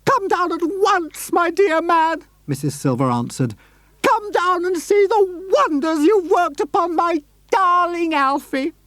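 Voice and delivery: high lady overjoyed; shrill excited lady. Acted. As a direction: squeal the good news. Missus Silver invites him.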